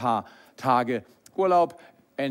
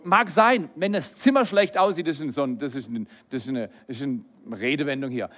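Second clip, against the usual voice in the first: ordinary talk, nothing marked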